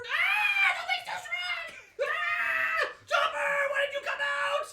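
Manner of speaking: very high, loud struggling yell; frantic shout. Acted. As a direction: yell as the wind grabs you. Chopper blown by wind.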